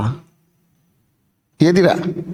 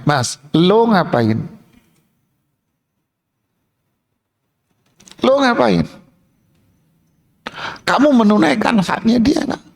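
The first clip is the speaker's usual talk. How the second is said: pointed questioning, rising higher; confronting ustadz; 5 semitones higher than usual. Acted. Ustadz confronting the husband, blunt and pointed. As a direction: challenge him bluntly.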